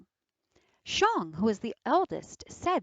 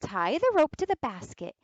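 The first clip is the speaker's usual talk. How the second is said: put-on clever girl's voice; 8 semitones higher, 4 dB louder than usual. Girl lays out the trap.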